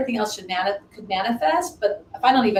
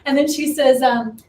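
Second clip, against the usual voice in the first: usual speaking voice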